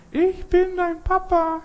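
very high, slow put-on voice; imitated fake voice. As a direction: squeak it out like a faker copying a voice. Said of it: "Stimmen nachmachen, imitieren". (gefälschte hohe Stimme, langsam)

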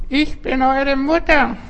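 high, slow falsetto of a fake mother; wolf faking sweetness. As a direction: disguise your voice as the mother. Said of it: "der Wolf verstellt seine Stimme".

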